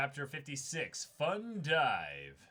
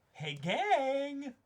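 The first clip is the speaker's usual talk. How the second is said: bright call-out; 12 semitones higher, 7 dB louder than usual. Acted. Calling out to the group.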